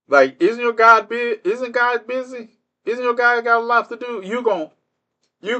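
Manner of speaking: high-pitched, needling questions; mock incredulity. Streamer asks heckler about his busy God, mock incredulity.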